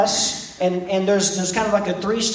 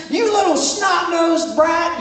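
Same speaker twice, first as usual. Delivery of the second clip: much higher, mock scolding screech; angry mother; 10 semitones higher than usual. Mother in Walmart yelling at her child, mock shriek. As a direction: play the furious mom catching her kid. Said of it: "and they're screaming".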